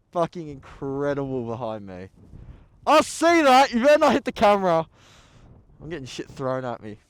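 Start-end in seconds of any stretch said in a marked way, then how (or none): 2.8-4.9 high loud fast warning shout; playful shout. Real, not acted. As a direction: yell a warning at the thrower. Shouting at snowball thrower.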